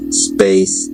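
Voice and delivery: ordinary delivery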